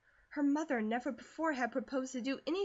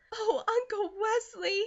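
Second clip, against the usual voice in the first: much higher, slow awed cry; overwhelmed by the gift, much higher; breathless stunned delight, much higher; delighted gasp; 11 semitones higher, 6 dB louder, slower than usual. A narrator's reading. Girl receives gift.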